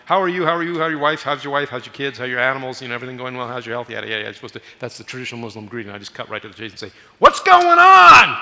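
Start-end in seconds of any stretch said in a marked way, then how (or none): 0.1-4.3 rattled-off greeting. Acted. 7.2-8.4 high, very loud drawn-out yell; acted shout over background noise; urgent shout. Cuts to the chase over noisy call.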